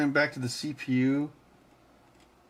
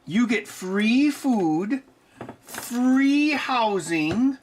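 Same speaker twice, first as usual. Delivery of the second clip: much higher, very slow whiny scolding; mock scolding; 10 semitones higher, 3 dB louder, much slower than usual. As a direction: drag out the complaint like a put-upon pet owner. Scolding the cat mockingly.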